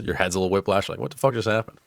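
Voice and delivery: ordinary talk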